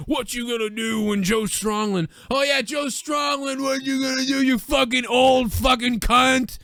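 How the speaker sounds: mock Hogan cursing out the caller. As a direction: scream it like a raging wrestler. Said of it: "he'd be like".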